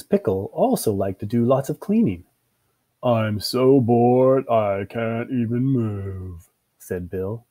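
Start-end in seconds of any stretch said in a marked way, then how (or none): 3.0-6.5 slow, monotone character drawl; listless slow complaint; bored. Acted. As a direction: drag every word out, too bored to move.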